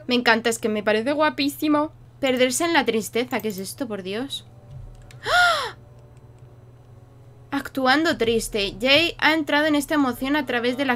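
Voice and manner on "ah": sharp high yelp of fright; a real jolt of surprise, cut short; sudden frightened cry; startled shriek. Real, not acted. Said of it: "Qué susto me he dado".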